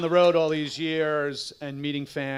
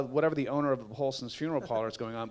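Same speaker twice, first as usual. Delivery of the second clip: ordinary delivery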